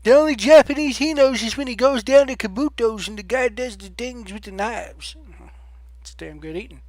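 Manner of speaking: a hint of a fussy fan voice, fading to a mumble; mocking a fan who sneers at the commentator; mock fan rant. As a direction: keep the sneering fan going, then trail off. Mocking fans ranting about him.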